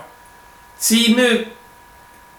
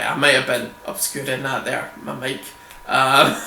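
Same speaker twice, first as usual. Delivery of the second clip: ordinary talk, nothing marked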